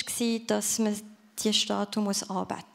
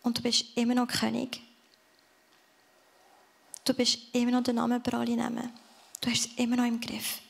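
heartfelt prayer. Real, not acted. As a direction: pray it gently to the Father. Closing prayer.

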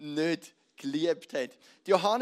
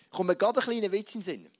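ordinary delivery

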